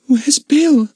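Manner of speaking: put-on high, frightened girl's voice. A narrator's reading. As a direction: ask through chattering teeth. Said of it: "said Lucy Ann with chattering teeth".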